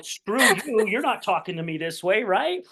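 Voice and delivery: high, defensive retort, half in jest; mock indignant. Man inwardly resisting blunt advice from his mentor, mock indignant. Acted defensive inner reaction to criticism.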